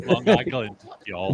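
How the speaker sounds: mock Long Island drawl; Long Island accent. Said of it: "Can you go back to your Long Island accent".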